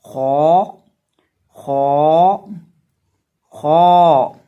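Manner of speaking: loud खौ with a thick, full-mouthed long vowel; drawn-out, emphatic खौ demo; heavy, full letter. Shown as a demonstration. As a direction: make खौ heavy and hold it out. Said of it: "खौ को हम पढ़ेंगे मोटा करके".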